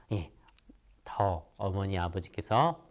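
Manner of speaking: ordinary talk, nothing marked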